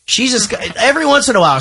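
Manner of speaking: ordinary talk, nothing marked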